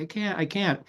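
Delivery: high-pitched mock plea; desperate pleading. Retelling a struggling player answering his coach, desperate protest. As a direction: imitate a player begging to give up.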